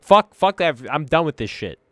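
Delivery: a god throwing a tantrum; fed-up God. Retold or imitated. God fed up before the Big Bang, mock angry outburst. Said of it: "He's like".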